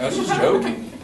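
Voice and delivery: high-pitched, half-laughing protest; mock pleading defense. Joker to tearful friend, mock pleading.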